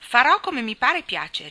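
defiant retort. A narrator's reading. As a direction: answer back, refusing to be bossed. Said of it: "ribellandosi subito".

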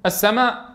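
السماء ending on a natural short-held aa; no extra lengthening. Shown as a demonstration. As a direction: say السماء without stretching the final alif. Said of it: "لكن لم نسمع الزيادة".

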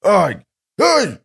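short high "ow" cries; yelps of pain. Acted. Sapper being punched by Detoran, yelping.

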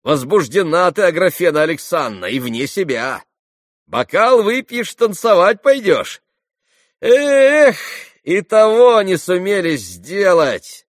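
high, loud jeering voice; sneering young man, loud and high; mocking Rakitin. A narrator's reading. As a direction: tease her loudly, almost yelling.

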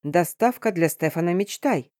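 ordinary delivery, nothing marked

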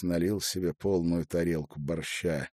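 plain reading voice

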